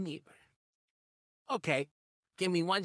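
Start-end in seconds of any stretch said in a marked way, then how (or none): 0.0-0.3 soft. Acted. Soothing the victim.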